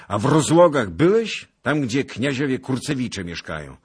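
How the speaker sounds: loud, high, insistent question; demanding interrogation. A narrator's reading. Lord questions a beggar about the princes' manor, demanding. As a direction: press him hard for an answer.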